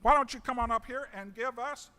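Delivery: ordinary talk, nothing marked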